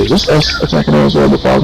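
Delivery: ordinary talk, nothing marked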